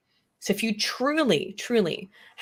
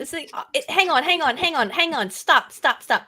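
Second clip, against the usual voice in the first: higher, louder burst of protest; urgent objection; 8 semitones higher, 4 dB louder than usual. Real, not acted. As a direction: cut in insistently to halt the other speaker. Cuts in to protest the label.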